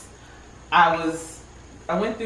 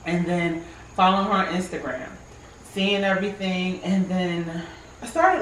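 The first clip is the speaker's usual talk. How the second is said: normal talking voice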